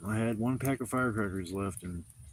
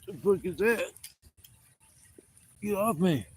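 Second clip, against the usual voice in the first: real startled cry, much higher; startled yelp; 9 semitones higher than usual. Real, not acted. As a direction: jump at the bug on him. A bug lands on him.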